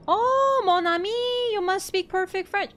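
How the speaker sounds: high, loud mock amazement; hammed-up Francophile exclamation; imagined gushing admirer. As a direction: gush in an exaggerated high-pitched voice. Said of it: "And might be like".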